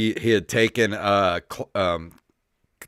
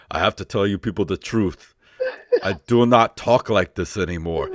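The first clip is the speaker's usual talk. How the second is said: measured mock Austrian-accented confession; solemn Austrian action-star impression; Arnold Schwarzenegger; 3 semitones lower than usual. Action star confessing to the public, put-on Austrian accent. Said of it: "he's gonna put one of those videos out".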